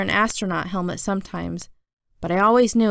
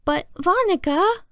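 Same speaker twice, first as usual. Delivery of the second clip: put-on high toddler voice, rising at the end; little brother Jamie; 11 semitones higher than usual. A narrator's reading. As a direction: pipe up high like a small child. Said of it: "said Jamie".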